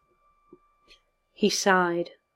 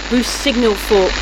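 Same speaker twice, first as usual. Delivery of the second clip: loud, higher voice through static; radio call cut off mid-phrase; crackling headset; 5 semitones higher, 7 dB louder than usual. A narrator's reading. Butler over failing connection, crackling headset. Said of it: "His headset crackled".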